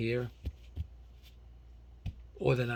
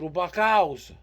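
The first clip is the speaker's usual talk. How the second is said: insistent; 4 semitones higher, 8 dB louder, slower than usual. Retold or imitated. Insisting to confused local.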